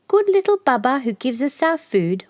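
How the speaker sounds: a sweet little bird singing to Baba. A narrator's reading. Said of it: "one would sing".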